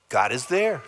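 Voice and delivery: normal talking voice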